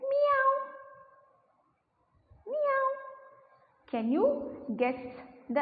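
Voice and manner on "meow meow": put-on high squeaky meow for the kids; cat meow. Shown as a demonstration. Teacher shows the class a cat's meow. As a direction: mew like a kitten, pitched way up. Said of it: "So when I said".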